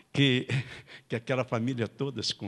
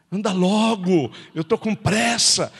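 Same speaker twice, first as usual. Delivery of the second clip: put-on louder, higher, slow impatient drunk at the door; 7 semitones higher, 8 dB louder, slower than usual. Drunk husband outside the door, impatient demand. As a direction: drag it out, demanding entry.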